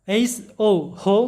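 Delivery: HO হো stretched slowly, হো with a round ও; slow spelling demo. Shown as a demonstration. Teacher slowly modelling হো for students. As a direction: sound it out syllable by syllable. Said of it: "তাহলে আমাদের সাউন্ডগুলো এরকম হবে".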